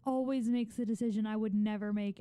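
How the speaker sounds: usual speaking voice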